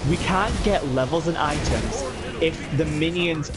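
ordinary talk, nothing marked

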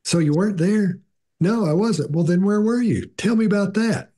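gently probing questions, a hint of role-play; ideal interrogator, lightly voiced. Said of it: "never does he say".

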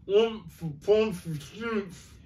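high muffled grunts; old uncle spluttering from under his hat. Acted. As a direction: mumble as if through a hat. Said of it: "voice from inside the hat".

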